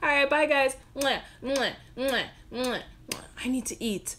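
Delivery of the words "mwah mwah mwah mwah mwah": between kisses. Real, not acted. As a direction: blow kisses to the viewers. Blowing kisses goodbye.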